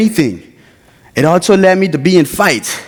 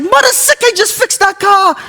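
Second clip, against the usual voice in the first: much higher, outraged shriek at the wrecked car; outraged cry. Retold or imitated. Son shrieking as the just-fixed car gets rammed, outraged. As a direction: yelp in disbelief, pitch way up.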